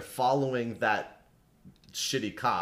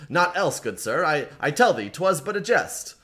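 higher, louder, snapping denial; sharp answer; 6 semitones higher, 5 dB louder than usual. A narrator's reading. Constable snapping back at Hendon about the pig, sharp and loud. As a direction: bite back fast and defensive. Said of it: "The man answered sharply".

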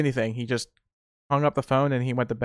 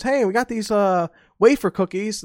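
grandma offering; 7 semitones higher than usual. Retold or imitated.

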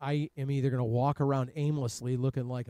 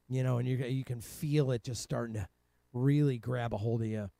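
ordinary talk

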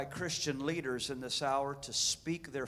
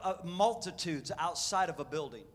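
usual speaking voice

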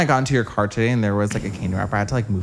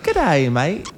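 higher-pitched amateur Aussie accent; Australian accent; 7 semitones higher than usual. Shown as a demonstration. Guest keeps trying despite being bad at accents, high amateur Australian accent. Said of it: "in an Australian accent".

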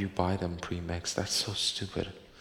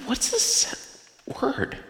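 baffled squeak; 3 dB louder, much slower than usual. Real, not acted. Stumbling over hard word.

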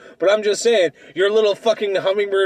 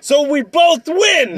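slow teasing shout; 6 semitones higher, 4 dB louder, much slower than usual. Real, not acted. Teasing the other debater.

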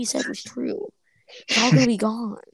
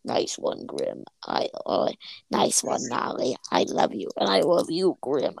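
villain duo voices; 6 semitones lower, slower than usual. Retold or imitated. Voices the villain duo congratulating each other.

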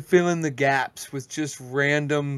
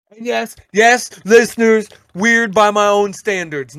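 louder, higher address to the audience; loud aside to listeners; 4 semitones higher, 11 dB louder than usual. Real, not acted. Talking straight to the podcast listeners, loud. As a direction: call out to the listeners.

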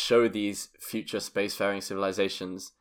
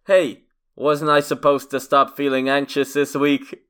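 New Yorker quote; 3 semitones higher, 5 dB louder than usual. Retold or imitated. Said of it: "this person wrote".

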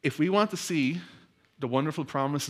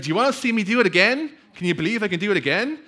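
fast, high, loud showman hype; hyping the crowd; 8 semitones higher, 6 dB louder, faster than usual. Retold or imitated. Tightrope walker hypes the crowd, fast and high. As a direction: whip the crowd up, quick.

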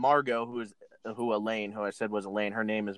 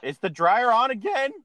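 calling out; 10 semitones higher, 6 dB louder than usual. Real, not acted. Hears a noise, calls out.

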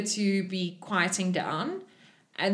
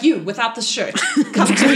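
raised, imperious summons with laughter creeping in; commanding summons; 3 semitones higher, 7 dB louder than usual. Acted. Game master's character summons the shirtless one, amused command. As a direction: call them over loudly, close to cracking up.

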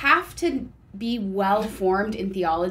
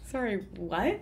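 breathless laugh, barely voiced; wheezing through laughter; laughed out, can't get the word out; 2 semitones lower, 10 dB quieter than usual. Real, not acted. Laughing too hard to speak.

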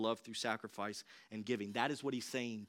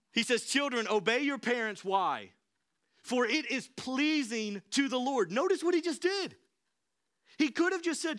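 higher and louder pulpit delivery; preaching at full force; 9 semitones higher, 4 dB louder than usual. Acted. Pastor stirring up the church about children, fervent preaching. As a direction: drive the point home to the congregation.

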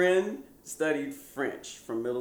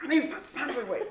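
higher indignant protest; student protesting the nickname, pitched up; indignant kid; 13 semitones higher than usual. Retold or imitated. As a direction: correct her, affronted. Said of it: "I was like".

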